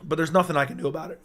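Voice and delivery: normal talking voice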